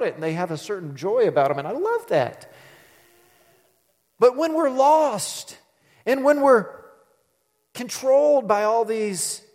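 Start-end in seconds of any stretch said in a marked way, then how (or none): 4.2-9.4 higher, louder, slow preaching; emphatic sermon delivery; preaching emphasis. Acted. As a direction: lift it up like a preacher. Preaching, raised voice.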